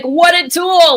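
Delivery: high, loud, jeering mock; the rabbit sneering at a fool, high and loud; rabbit's scorn. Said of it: "And the rabbit is like".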